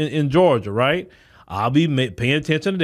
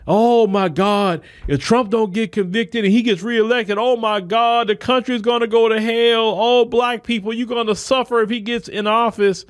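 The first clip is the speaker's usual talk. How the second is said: mock the fearmongers in a higher, over-the-top panic; 7 semitones higher than usual. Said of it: "they trying so hard with the Trump thing".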